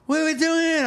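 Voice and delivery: very high, mock clueless question; high-pitched imitation; imitated drunk women.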